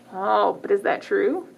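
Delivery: plain reading voice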